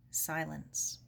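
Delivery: ordinary delivery, nothing marked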